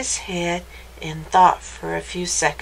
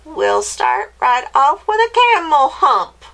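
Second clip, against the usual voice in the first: raised, louder witch voice; screechy witch; 5 semitones higher, 5 dB louder than usual. Acted. Witch beginning to transform the dog, screechy voice. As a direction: announce the spell like a screechy witch. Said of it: "in her high, screechy voice".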